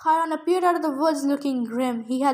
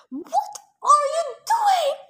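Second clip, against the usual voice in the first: high-pitched yelled question; yelling; 12 semitones higher than usual. A narrator's reading. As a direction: shout it across, pitch way up. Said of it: "Thalia yelled off to my left".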